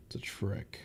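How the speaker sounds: whisper. Real, not acted. Whispered aside.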